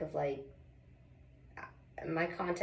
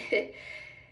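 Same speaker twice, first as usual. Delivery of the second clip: breathy giggle after whisper. Real, not acted. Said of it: "I'm not really sorry".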